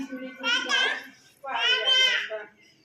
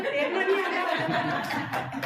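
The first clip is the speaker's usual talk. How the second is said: laughing taunt; 4 semitones higher, 5 dB louder, much slower than usual. Acted. Boatman turns the taunt back.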